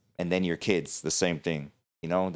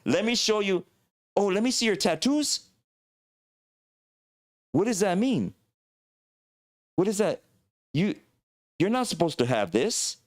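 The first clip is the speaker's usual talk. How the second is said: incredulous warrior; 9 semitones higher than usual. Pretend. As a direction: scoff and correct them. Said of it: "I could only imagine the things like".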